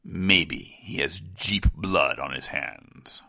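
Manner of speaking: slow, whispered accusation; ominous whisper. Acted. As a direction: whisper it slowly like a dark secret. Dark accusation punchline.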